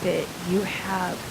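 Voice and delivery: lightly acted awkward boss, soft and halting; slow, hushed reluctant confrontation; hesitant boss. Boss telling Jenny about Vicodin on her breath, hesitant and soft. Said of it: "No one's ever gonna come up and go".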